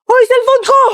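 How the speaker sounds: high, loud yell to stop him; alarmed yell; shout after a thief. Acted. Yelling at the phone thief.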